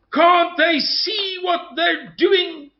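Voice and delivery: high, slow, loud cry of disbelief; exasperated mock cry. Quoting people who can't make sense of South Africa, exasperated cry. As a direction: cry it out as a baffled onlooker, drawn out. Quotes people baffled by events.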